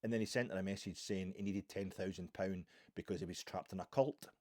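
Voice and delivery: usual speaking voice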